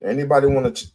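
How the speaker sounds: normal talking voice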